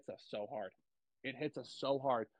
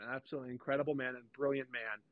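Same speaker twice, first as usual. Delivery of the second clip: ordinary talk, nothing marked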